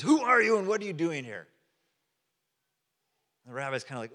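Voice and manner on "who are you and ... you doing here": higher, louder gruff challenge from a guard; a hint of a gruff Roman guard challenging a stranger; gruff centurion. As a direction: bark it down from the wall like a soldier. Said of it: "this Roman centurion very gruffly says".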